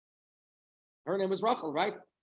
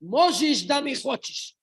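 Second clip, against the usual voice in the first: stern, slow, raised peasant voice; 7 semitones higher, 8 dB louder, slower than usual. Retold or imitated. Wagon driver sternly rebuking the students, slow and loud. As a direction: lay it down sternly, high and loud. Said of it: "very sternly says".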